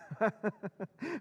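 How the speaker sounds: a pleased, knowing chuckle; smug little laugh; amused chuckle. Acted. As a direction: chuckle at your friend's disbelief. Walter delighted by the fooled friend.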